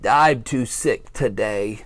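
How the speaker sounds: a hint of a weary sick bear, slow. Bear turns down his friends, slow and weary. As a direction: drag it out like you feel awful. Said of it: "Bear shakes his head".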